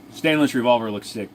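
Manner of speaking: usual speaking voice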